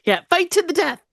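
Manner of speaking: high, gleeful goading; lightly voiced instigator; mock egging on. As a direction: stir up the fight cheerfully. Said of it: "acting the troublemaker egging on".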